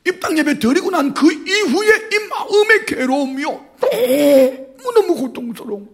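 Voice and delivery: high, tearful voice close to crying; voice breaking. Real, not acted. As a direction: let the pain crack the voice upward. Pastor recalls torment, voice breaks.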